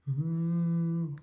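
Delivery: usual speaking voice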